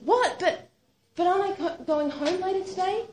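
high, shocked question; stunned, pleading for it not to be true; shocked disbelief. Retold or imitated. Told she's going into surgery.

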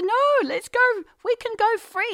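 high, loud eager plea; eager pleading. Retold or imitated. Wife urging her husband to go, high and eager. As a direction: push him, full of excitement.

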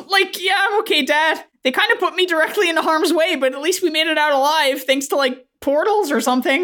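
put-on high-pitched, squeaky teen voice; teenage Tyler. Tyler reassures his dad, high-pitched and awkward. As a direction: answer dad in a high, hesitant kid voice. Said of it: "Tyler says".

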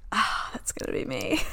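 soft, breathy whispered thought; awed wishful whisper. Retold or imitated. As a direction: whisper it as a private daydream. Dreamy thought looking at model pictures.

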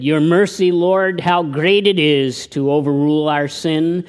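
reading a poem aloud; poem recitation. Acted. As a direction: declaim the verse. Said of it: "Dennis Fisher wrote a poem".

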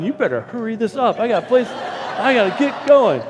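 impatient aside. Retold or imitated. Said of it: "in the background, I'm going".

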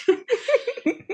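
a sudden high self-mocking laugh. Real, not acted. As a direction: burst into high laughter at yourself. Laughs at herself as scientist.

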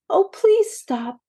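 high desperate plea; pleading. A narrator's reading. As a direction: beg them to stop, pitched high. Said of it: "begged Maisie".

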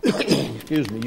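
normal talking voice

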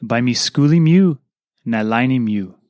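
usual speaking voice

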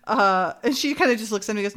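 normal talking voice

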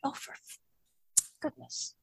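whispered under the breath, dismayed; hushed for goodness; exasperated whisper. Retold or imitated. Told to talk on hope.

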